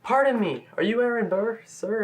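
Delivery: high-pitched mock-nervous approach; imitating nervousness. Imitating Hamilton nervously approaching Burr, mock-nervous. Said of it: "he sounds very nervous".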